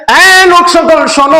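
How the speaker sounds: high, drawn-out call to a crowd. Retold or imitated. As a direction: hail the people to make them listen. Prophet calls out to the crowd.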